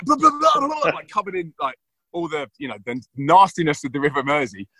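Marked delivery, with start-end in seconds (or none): 0.0-0.9 high, loud spluttering babble; spluttering kid. Retold or imitated. Dad mimics Jack spluttering river water, high and loud. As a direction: splutter like a child pulled from the water. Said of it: "he was like".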